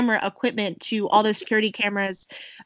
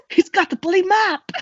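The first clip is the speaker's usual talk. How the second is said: put-on British accent; 10 semitones higher, 5 dB louder than usual. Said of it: "that's exactly it".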